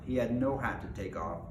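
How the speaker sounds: ordinary delivery, nothing marked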